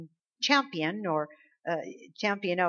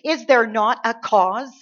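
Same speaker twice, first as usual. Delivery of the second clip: very loud, slow, emphatic challenge; rallying cry; 3 semitones higher, 9 dB louder than usual. Real, not acted. Preacher urging believers to take up the cause, rallying. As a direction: ring out each word to rally the room. Preacher rallies listeners with the quote.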